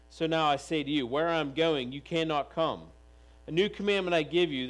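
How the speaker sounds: loud, full-voiced scripture read to the congregation; preaching scripture reading. Preacher reads Jesus' words to the church, loud and proclaiming. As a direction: proclaim each line of the passage.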